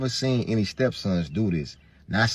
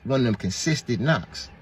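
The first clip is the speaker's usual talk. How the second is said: ordinary talk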